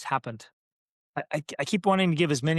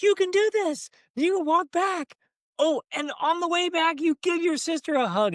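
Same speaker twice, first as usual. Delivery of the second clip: high, bright mock-cheering voice; playful encouragement; 4 dB louder than usual. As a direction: coach her along, playfully. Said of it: "I was playful with her and fun".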